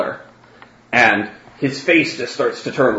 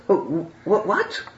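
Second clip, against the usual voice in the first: high, stammered what; jolted out of a bored doze; startled; 10 semitones higher, 3 dB quieter than usual. Acted. Bored young Wolfgang hearing the refusal, startled stammer. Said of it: "he's kinda startled awake".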